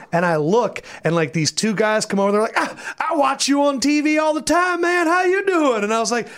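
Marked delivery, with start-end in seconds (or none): 2.6-5.8 high, eager fan greeting; imitating an excited sports fan. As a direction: gush like a starstruck fan. Fans greet him excitedly.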